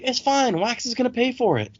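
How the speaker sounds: high, carefree brush-off; breezy Wayne. Retold or imitated. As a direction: toss it off lightly, way up high. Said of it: "And Wayne's like".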